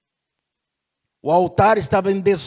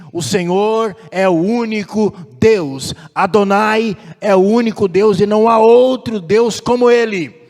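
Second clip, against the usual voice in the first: loud, raised proclamation building in force; fervent preacher voicing the crowd's cry; 4 semitones higher, 8 dB louder than usual. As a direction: declare it as a whole people crying out. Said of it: "Eles clamam novamente e dizem".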